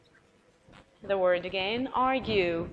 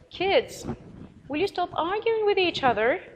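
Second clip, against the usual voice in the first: put-on pitched high and raised, telling the kids to stop; parent scolding kids; 8 semitones higher than usual. Shown as a demonstration. Parent telling arguing children to stop, high and raised. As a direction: call the squabbling kids to order from up high. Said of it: "You're talking to the children".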